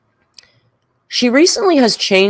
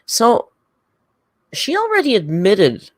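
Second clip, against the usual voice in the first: normal talking voice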